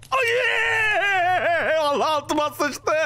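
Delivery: high, panicked cry; startled high-pitched yelp; jumpscare panic. Real, not acted. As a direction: shriek out in real fright. Horror game jumpscare.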